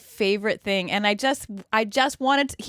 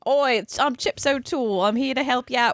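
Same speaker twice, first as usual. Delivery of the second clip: a bouncy put-on Australian accent; playful Australian accent impression; mock Australian accent; 6 semitones higher than usual. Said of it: "he, like, says".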